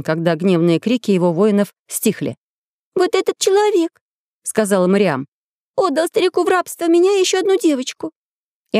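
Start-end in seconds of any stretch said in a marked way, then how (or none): 2.9-4.0 put-on high, childlike girl's voice; little girl voice. A narrator's reading. 5.8-8.1 put-on high, childlike girl's voice; little girl voice. A narrator's reading.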